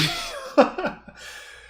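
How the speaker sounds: shrieking high laugh; high laugh burst. Real, not acted. Calling the friend's answer bullshit, then a high shrieking laugh.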